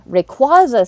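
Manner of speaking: "Rayquaza" as ray-KWAH-za; preferred pronunciation. Shown as a demonstration. Weighing which version sounds good, "Rayquaza" as ray-KWAH-za. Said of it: "I like the way that sounds".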